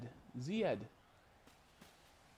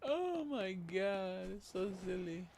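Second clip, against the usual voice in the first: laughing; 8 semitones higher, much slower than usual. Real, not acted. Laughing at comment.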